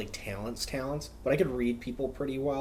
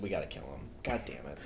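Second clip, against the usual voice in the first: low, soft conspiratorial grumble; gruff agent voice; 10 semitones lower, 10 dB quieter, faster than usual. Retold or imitated. Secret agent cursing about the kids, low muttered grumble. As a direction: mutter it like a spy covering up. Russian agent plotting in the school.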